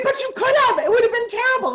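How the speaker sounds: high, loud mock whine of her son; mock whiny son. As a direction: mimic the son's whiny protest. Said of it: "He said".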